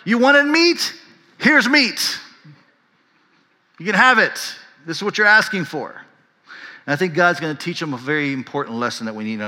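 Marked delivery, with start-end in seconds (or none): 0.0-6.0 high, loud, fed-up retort; exasperated God. Retold or imitated. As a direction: snap back like a fed-up father. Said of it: "God's like".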